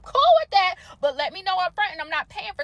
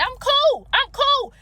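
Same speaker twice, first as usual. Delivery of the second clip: higher and louder, protesting too much; insistent, worked-up "I'm cool"; 8 semitones higher, 5 dB louder, much slower than usual. Real, not acted. Upset customer insisting to viewers she's calm, higher and louder. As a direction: insist you're calm while clearly heated.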